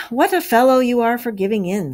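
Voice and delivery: plain reading voice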